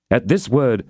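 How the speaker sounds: ordinary delivery, nothing marked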